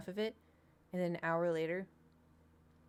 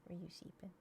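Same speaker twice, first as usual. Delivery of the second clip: whisper to pet; 14 dB quieter than usual. Real, not acted. Owner whispers to her sleepy pet, tender hush. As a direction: breathe the question so the pet stays calm.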